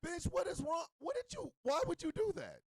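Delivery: high, hammed-up outrage; exasperated disbelief. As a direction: confront them in a high, baffled voice. Acts out confronting the partner.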